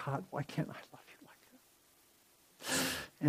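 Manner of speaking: hushed weeping prayer. Retold or imitated. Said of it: "he starts weeping".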